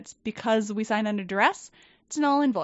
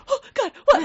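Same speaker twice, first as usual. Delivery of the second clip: much higher and louder frantic cry; mock panic; 8 dB louder than usual. Jumpy new hire answering her boss, in a high frantic cry. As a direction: jump in alarm like you're in trouble.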